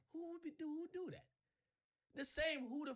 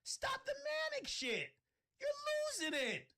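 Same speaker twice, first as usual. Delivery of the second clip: high shout; 5 dB louder than usual. Real, not acted. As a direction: scream it at her, fed up. Escalating rant at Desiree.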